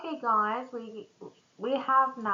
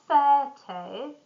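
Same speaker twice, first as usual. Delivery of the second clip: "thirty" slow, stressed on the first syllable, THIR-ty; 3 semitones higher, 5 dB louder than usual. Shown as a demonstration. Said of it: "stress on the beginning".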